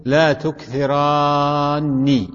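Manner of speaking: تكثران with the alif greatly drawn out before a doubled ni; slow, stretched-out تكثران, every sound of the ending voiced; long sounded alif. Shown as a demonstration. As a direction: hold the long aa in تكثران, then land on ni. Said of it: "وهذا ملفوظ به".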